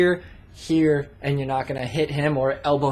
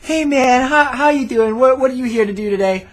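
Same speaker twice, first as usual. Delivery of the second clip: much higher, much louder, hammed-up pumped instructor; mock the overly peppy skydiving cameraman; energetic instructor; 8 semitones higher, 8 dB louder than usual.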